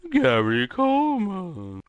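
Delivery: high, slow ghostly moan. Acted. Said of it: "I like his ghost voice".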